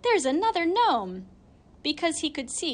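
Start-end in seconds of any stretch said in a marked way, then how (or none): 0.0-1.3 high, loud and alarmed; startled cry of a small boy; excited cry. A narrator's reading. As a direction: point and cry out in fright. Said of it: "he cried".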